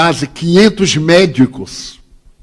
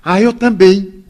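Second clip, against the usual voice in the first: higher, slow, imitated chiming in; retold eagerness; 5 semitones higher, 4 dB louder than usual. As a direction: pipe up as the pestering stranger. Imitates the pestering man's eager reply.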